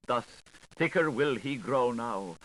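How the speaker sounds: usual speaking voice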